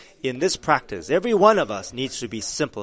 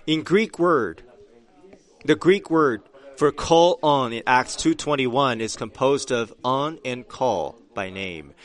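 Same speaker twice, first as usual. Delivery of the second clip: ordinary talk, nothing marked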